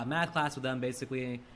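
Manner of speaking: ordinary talk, nothing marked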